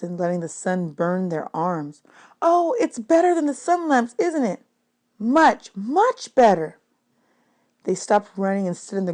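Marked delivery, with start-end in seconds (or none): 2.4-4.6 put-on high, delighted child voice; excited child. 5.2-6.8 delighted. Acted. Child agreeing in delight.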